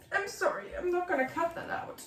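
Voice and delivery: high, soft apology through tears; tearful apology. Real, not acted. Writer apologising to viewers for crying, small tearful voice. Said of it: "embarrassing to cry on camera".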